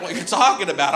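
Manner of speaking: ordinary talk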